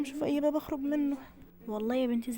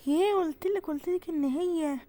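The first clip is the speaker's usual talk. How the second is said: high excited cry, then slow and drawn out; thrilled I-told-you; excited recognition; 6 semitones higher, 4 dB louder, slower than usual. Acted. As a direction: burst out in delighted disbelief. Recognises lost daughter.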